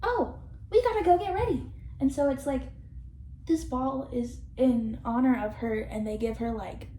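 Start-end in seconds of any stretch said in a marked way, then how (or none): 0.0-1.7 higher, hurried get-ready voice; lightly voiced eager girls; mock eager friends. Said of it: "they're immediately like".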